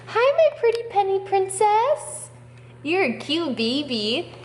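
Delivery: high, sing-song pet talk. Real, not acted. Owner cooing to her cat Penny, high baby talk.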